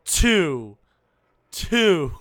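loud, booming single word; drawn-out, loud joke announcement; playful announcing. Pretend. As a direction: belt the number out playfully. Playfully hammers the topic number.

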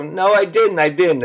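high-pitched mock-tearful kid protest. Crying boy contradicting his parents, mock-tearful. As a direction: imitate a child insisting through tears. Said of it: "I'm on the floor crying".

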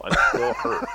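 high, loud, laughing protest; laughing confession. Real, not acted. Harry Potter fan confesses hurt to co-hosts, laughing. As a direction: laugh while owning up to the hurt.

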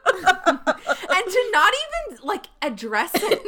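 high, loud, drawn-out outrage; loud indignation. Real, not acted. Co-host complaining he never addressed it, loudly indignant. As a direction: push the pitch up in disbelief. Indignant rant about the Bachelor.

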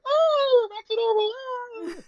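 very high mock cartoon squeal; silly spinning skaters. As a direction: imitate giddy skaters squealing as they whirl. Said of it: "silly how they were spinning around".

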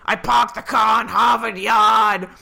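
loud, hammed-up Boston accent. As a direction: belt it out like a mock Bostonian. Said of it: "American from Boston".

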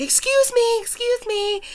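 startled call to the neighbor. Retold or imitated. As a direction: call out sharply to get attention. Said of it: "and I was like".

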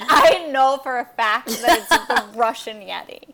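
mock confident declaration; mock certainty. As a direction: play the co-host barging in guns blazing. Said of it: "and you're like".

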